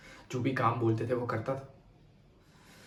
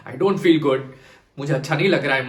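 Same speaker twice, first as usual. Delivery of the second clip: louder, insistent retold pleading; desperate protest; 2 semitones higher, 8 dB louder than usual. Survivor recalling begging parents not to send him, desperate. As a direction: plead it urgently, raising the voice.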